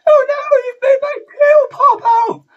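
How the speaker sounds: very high, loud shouted squeal; bear voice. Retold or imitated. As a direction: squeal it like a flustered little bear. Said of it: "finish with him saying".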